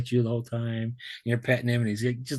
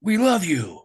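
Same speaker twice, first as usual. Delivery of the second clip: higher, louder, loving call of many voices; voice of adoring crowd; 8 semitones higher, 5 dB louder, much slower than usual. Retold or imitated. Worlds of people calling his name, higher, loving. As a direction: call out to him with overflowing love. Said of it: "they go".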